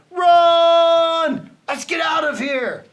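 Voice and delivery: Tiny shouts at the team to run; yelled. A narrator's reading. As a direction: scream to flee. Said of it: "Tiny yelled".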